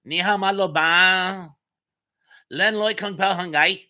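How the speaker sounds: showing off the little Cantonese he knows; attempted Cantonese. Shown as a demonstration. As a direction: blurt out half-remembered Cantonese. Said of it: "Here, I'll try it".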